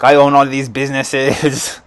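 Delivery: put-on slow Austrian accent, boasting; Arnold Schwarzenegger accent. Arnold snapping back at the speaker, in a slow Austrian accent. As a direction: drag it out in a thick Austrian accent. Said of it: "I'm not gonna do the accent anymore".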